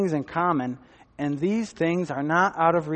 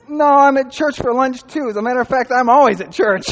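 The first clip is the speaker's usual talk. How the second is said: mock guy's reply; 8 semitones higher, 10 dB louder than usual. Guy's reply retold on phone.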